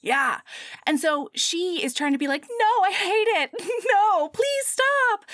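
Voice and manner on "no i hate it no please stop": high squealing fake protest; mock protest. Heroine fending off the hero, high mock squeal.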